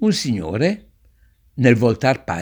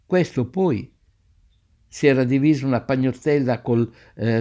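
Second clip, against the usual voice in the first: usual speaking voice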